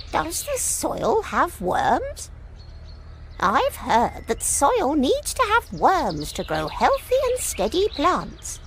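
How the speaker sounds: high, soft, slow hen voice; a fussy hen asking gently, high and hushed; slow, hushed, high-pitched hen; little red hen. Acted. Maple the hen speaks.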